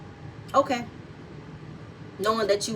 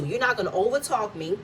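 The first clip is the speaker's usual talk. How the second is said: normal talking voice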